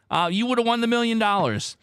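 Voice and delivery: ordinary talk, nothing marked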